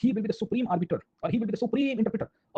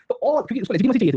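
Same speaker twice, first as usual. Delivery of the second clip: laughing; 5 semitones higher, 8 dB louder than usual. Real, not acted. Laughing at Akbar's sword trick.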